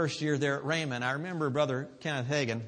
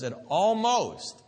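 usual speaking voice

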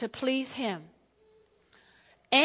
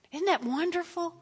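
usual speaking voice

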